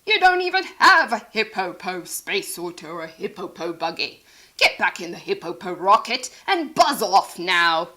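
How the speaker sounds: mock and send her off; scornful Hercules. Hercules dismisses Sheila.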